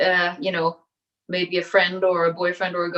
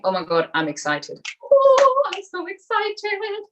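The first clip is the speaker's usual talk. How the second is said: excited; 12 semitones higher, 4 dB louder than usual. Shown as a demonstration. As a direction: burst out with delighted excitement. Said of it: "start saying".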